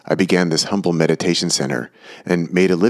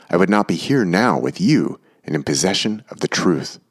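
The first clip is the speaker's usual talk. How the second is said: ordinary delivery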